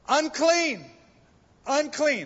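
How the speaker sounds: high, loud leper's cry of warning; yelling Unclean. Retold or imitated. Loud shout of Unclean. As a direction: leper crying out to warn people away. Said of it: "you had to yell out".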